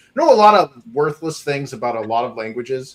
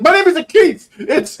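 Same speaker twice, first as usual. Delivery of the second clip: higher, louder hammed-up indignation; retelling Hugh Laurie's sketch, loud high indignant; indignant correction; 9 dB louder than usual. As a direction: play an outraged sketch character insisting. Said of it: "He's like".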